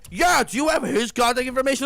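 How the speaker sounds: high, loud mocking demand; pushy demand. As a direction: ape the customer's loud pushy ask. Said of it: "He's like".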